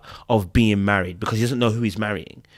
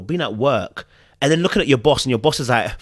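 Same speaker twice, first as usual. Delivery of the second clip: ordinary talk, nothing marked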